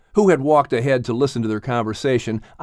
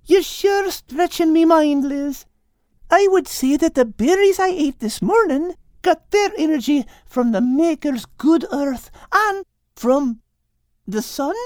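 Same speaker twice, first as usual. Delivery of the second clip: put-on young girl voice. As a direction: ask it like a puzzled girl. Kate's lines.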